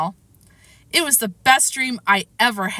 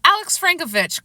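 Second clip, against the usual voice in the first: calling out; 7 semitones higher than usual. A narrator's reading. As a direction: coach calling a name, high-pitched. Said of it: "called my coach".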